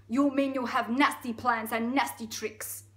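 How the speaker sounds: loud, slow Russian reproach; full Russian accent. Acted. Elephant challenging the crocodile's plans, Russian accent. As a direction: accuse in a thick Russian accent. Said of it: "It's gone full Russian now".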